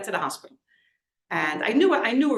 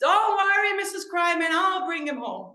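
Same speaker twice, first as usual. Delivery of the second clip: tipsy reassuring shout; 8 semitones higher, 3 dB louder than usual. Retold or imitated. Drunk friend reassures mother.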